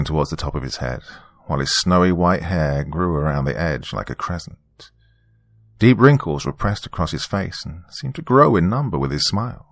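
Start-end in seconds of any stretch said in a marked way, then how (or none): none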